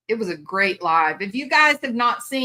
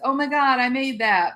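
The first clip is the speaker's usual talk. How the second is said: higher, louder proud "Oh my God"; proud gush; 6 semitones higher, 3 dB louder than usual. Retold or imitated. New mom marveling at her baby, higher and louder. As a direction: beam with pride. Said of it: "When we have our babies, it's".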